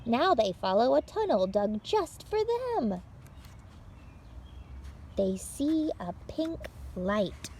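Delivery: bright, lively read-aloud for little listeners; picture-book reading. Acted. Librarian reading a picture book to children, lively read-aloud. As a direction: tell it like story time for small children.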